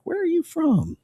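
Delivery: high, breathy mock girl asking a question; mock woman's voice. A woman meeting Steve-O asks where he is from, in a high mock voice. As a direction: squeal it like an impressed woman.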